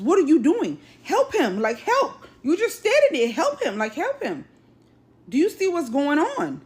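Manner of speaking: high-pitched imitation of desperate pleading. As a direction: urge an onlooker to step in, pitch leaping. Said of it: "the young lady at this point was desperate".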